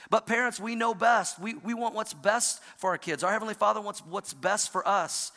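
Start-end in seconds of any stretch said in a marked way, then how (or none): none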